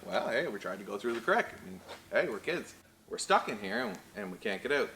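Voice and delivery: ordinary talk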